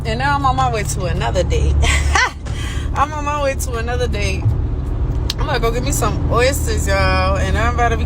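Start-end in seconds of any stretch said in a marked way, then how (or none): 1.8-2.9 a high breathy burst of laughter; real laugh. Real, not acted. Laughing at her own story.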